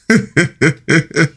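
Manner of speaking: high bright burst of laughter; laughing. Real, not acted. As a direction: laugh at your own joke. Laughs after joking line.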